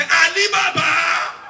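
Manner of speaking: loud, high, long-held call; hailing shout. Retold or imitated. Someone looks at him and hails Ali Baba, drawn-out shout. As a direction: bellow the name, stretching it out.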